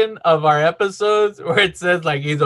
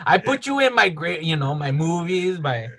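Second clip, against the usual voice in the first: Lucas. Acted. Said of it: "that's the way Lucas talks".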